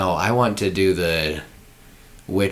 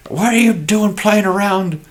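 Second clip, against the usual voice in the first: put-on grouchy old man; 10 semitones higher than usual. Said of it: "some grouchy old, uh, man".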